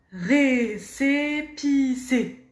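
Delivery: récépissé very slow, each closed é clear; slow syllable by syllable. Shown as a demonstration. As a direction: spell out récépissé slowly for learners. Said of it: "beaucoup de «et»".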